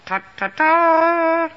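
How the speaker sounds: high, loud vocal trumpet notes; horn imitation. A narrator's reading. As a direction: blow three notes, holding the last. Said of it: "a faraway horn blow three notes".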